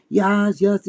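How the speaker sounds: a hint of an old German woman saying yes; German accent. Imitating his German ex-mother-in-law answering him, mild German accent. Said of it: "'cause she has a German accent".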